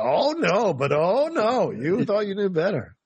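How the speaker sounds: high, lightly put-on voice; mock quote. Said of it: "You go".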